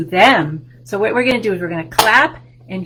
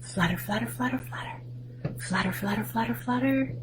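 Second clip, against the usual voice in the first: soft, partly whispered flutters; 2 semitones higher, 11 dB quieter than usual. Pretend. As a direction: breathe it out like wings. Butterfly sound in rhyme.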